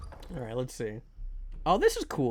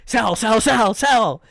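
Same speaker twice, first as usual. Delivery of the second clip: panicked stock trader; 6 dB louder than usual. Acted. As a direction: shout it like a trader dumping stock. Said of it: "people on the New York Stock Exchange would be like".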